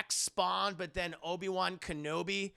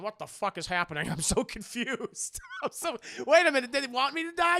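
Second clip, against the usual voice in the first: put-on baffled voice, rising higher and louder; bewildered Martin Sheen; 6 semitones higher, 4 dB louder than usual. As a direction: play confusion breaking into mock panic. Acts baffled Martin Sheen, laughing.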